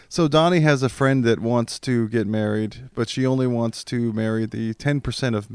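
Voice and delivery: usual speaking voice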